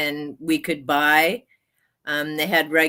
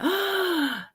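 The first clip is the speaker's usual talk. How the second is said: excited gasp; 13 semitones higher, 3 dB quieter than usual. Real, not acted. Excited about chocolate cake.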